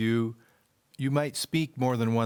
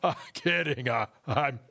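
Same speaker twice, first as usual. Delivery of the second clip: laughing; 3 semitones higher than usual. Acted. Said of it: "the tree laughed".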